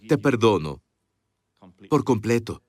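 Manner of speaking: low, tender and deliberate; full forgiveness. Shown as a demonstration. Preacher showing the right words to someone who asks forgiveness, tender. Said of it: "La persona necesita que digas".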